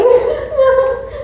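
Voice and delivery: shrill burst of joyful laughter; delighted high laugh. Acted. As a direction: laugh out in triumph. After proposal news.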